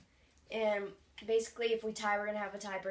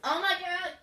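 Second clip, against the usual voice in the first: higher, louder laughing outburst; 9 semitones higher, 6 dB louder than usual. Real, not acted. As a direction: burst out laughing. Laughing at sour candy.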